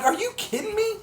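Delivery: squeaky, very high exasperated outburst; incredulous disbelief. Retold or imitated. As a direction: pitch shoots way up in disbelief. Exasperated at the unmarked lobby door.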